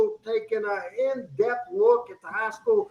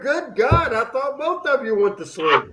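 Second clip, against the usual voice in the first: raised, louder jab at a lukewarm co-host; mock exasperation; 5 semitones higher, 5 dB louder than usual. Real, not acted. Host ribbing co-host over lukewarm answer, exasperated. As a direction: needle them with exasperated sarcasm.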